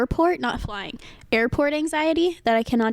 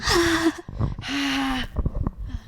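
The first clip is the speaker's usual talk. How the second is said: long, drawn-out breath to calm down; long sigh; 2 semitones higher than usual. Retold or imitated. Flustered traveler trying to calm down, long sigh. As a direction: exhale slowly and audibly, acting it out. Acting out calming down.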